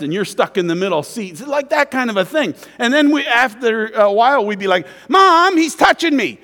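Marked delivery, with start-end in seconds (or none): 5.1-6.4 high, loud whining to mom; whiny tattling little sibling. Retold or imitated. Siblings tattling in back seat.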